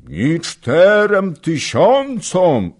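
slow, high, drawn-out mock voice; mocking mimicry. A narrator's reading. Old nobleman mocking the young wife's boast, slow and high. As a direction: parrot her in a teasing sing-song. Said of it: "rzekł, przedrzeźniając Zagloba".

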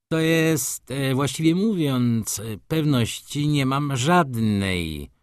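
high, halting, drawn-out hedging; hesitant evasion. A narrator's reading. Maruszewicz answers the judge, hesitant and evasive.

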